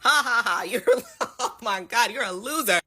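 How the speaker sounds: jeering laugh through the words; taunting crowd imitation with real laughs; mocking laughter. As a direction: laugh at a loser, mock the crowd. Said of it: "pointing, laughing".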